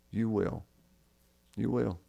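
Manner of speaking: soft, hushed "You will" said twice; hushed promise. Acted. Preacher echoing Jesus' words to the dying thief, hushed. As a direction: let the promise settle gently, almost under the breath.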